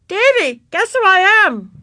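put-on high, loud child's call; eager playground holler; yelled challenge. A narrator's reading. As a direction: shout it to a playmate. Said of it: "yelled Ramona".

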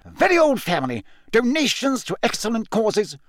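huffy, affronted justification. A narrator's reading. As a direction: rattle it off, offended. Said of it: "visibly affronted".

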